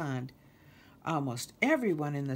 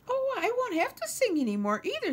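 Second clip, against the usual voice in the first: very high, louder bird voice; breezy mockingbird; 5 dB louder than usual. Lulu dismisses singing to Kermit, breezy high bird. Lulu gushes about the mall.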